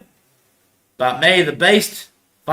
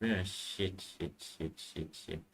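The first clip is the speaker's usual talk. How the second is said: muttered curse; 10 semitones lower, 24 dB quieter, much slower than usual. Real, not acted. Curse after laughing.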